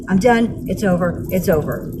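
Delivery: low, spent, giving up; drained resignation. Real, not acted. Woman venting about a court clash, drained resignation. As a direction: let the voice drop low with finality.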